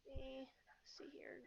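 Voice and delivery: quiet, slow, half-whispered; muttering to self. Real, not acted. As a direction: mumble it to yourself while searching. GM checking notes under breath.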